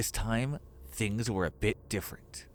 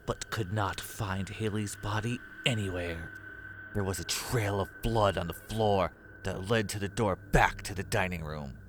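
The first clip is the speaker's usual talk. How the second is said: ordinary delivery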